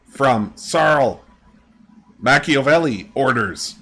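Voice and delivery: high, halting creature voice, word by word; high, broken bird voice. Acted. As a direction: struggle out each word like a bird learning speech. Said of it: "And he goes".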